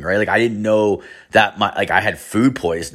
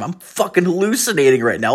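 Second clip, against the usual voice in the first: retold panic; 8 semitones higher than usual. Realises he is seeing people.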